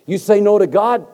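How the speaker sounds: loud, high pulpit cry; preaching at full force. Acted. Preacher warning the congregation, at full force. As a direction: shout the warning to the crowd.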